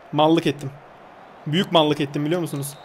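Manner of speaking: usual speaking voice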